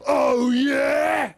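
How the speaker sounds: loud, high shouted challenge; bellowed. A narrator's reading. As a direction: bellow it like a monster. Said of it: "he bellowed".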